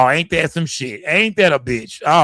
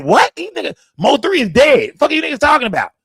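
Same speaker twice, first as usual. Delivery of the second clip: high-pitched, incredulous retold outburst; outraged disbelief; 10 semitones higher, 3 dB louder than usual. Streamer answering critics who accuse him of dividing the city, retold indignant outburst. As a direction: throw the retort back, pitch shooting way up.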